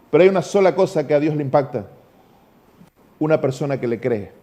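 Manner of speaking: normal talking voice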